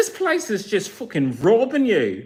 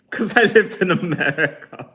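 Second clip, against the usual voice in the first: laughing. Real, not acted. Laughs at own accent joke.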